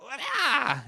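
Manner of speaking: high, loud attack shriek; kicking yell. Shown as a demonstration. Voice actor showing his noise for kicking someone, high attack yell. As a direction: burst of fighter's effort. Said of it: "what noise you make when you're".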